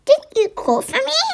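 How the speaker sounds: high, questioning mouse voice; confused little mouse. Acted. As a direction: lift it high like a puzzled mouse. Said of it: "He was so soft and confused".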